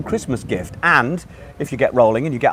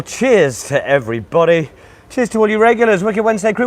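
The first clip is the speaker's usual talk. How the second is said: ordinary delivery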